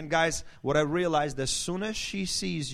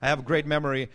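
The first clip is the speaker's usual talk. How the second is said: ordinary delivery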